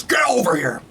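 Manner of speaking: mild Scorpion battle cry; a light take on the famous yell; imitating Scorpion. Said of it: "Scorpion comes in and goes".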